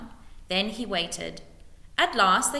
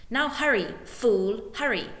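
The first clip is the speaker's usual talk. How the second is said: impatient urging; 3 semitones higher than usual. A narrator's reading. Lord driving his officer away, impatient. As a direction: hurry him along, raised.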